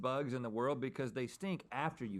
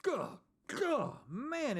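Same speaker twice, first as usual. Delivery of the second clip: gagging; 12 semitones higher, much slower than usual. Real, not acted. Gagging at the smell.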